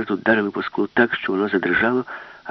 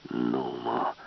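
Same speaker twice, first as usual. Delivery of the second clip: softer, lower whisper; 8 semitones lower, 6 dB quieter than usual. Acted. Professor whispers to Alice to run, low hushed. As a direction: whisper the escape plan.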